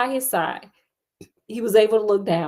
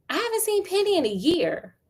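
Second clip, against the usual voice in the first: indignant denial; 8 semitones higher, 3 dB quieter than usual. Acted. Acted denial in argument.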